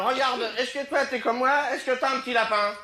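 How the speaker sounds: put-on high, bright-eyed child asking; little girl voice. Little girl asking the old lady, high and curious.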